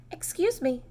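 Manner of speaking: high, cheery greeting; bright and friendly. A narrator's reading. Kind old woman greets Mister Cream brightly at the door. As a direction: chirp it kindly like a sweet old lady. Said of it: "she said brightly".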